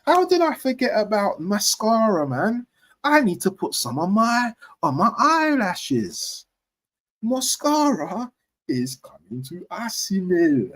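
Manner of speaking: high, slow, playful mock-camp; camp joking voice. Fan mocking the name Mascara, camp.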